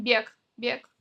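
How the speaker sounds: бег ending in a crisp "k"; devoiced final consonant. Shown as a demonstration. Teacher showing real pronunciation, бег with final k. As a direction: say бег as "byek", twice. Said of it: "говорим".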